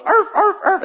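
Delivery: high yapping mock bark; cartoon dog bark. Pastor imitates the game dog cheering the runner, high yappy barks. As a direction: yap it out like a tiny video game dog. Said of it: "there's this dog goes".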